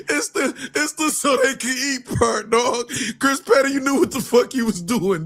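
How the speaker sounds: high, still giggling while explaining; half-laughing complaint to the donor; laughing through it. Real, not acted. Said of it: "I'm fucking crying".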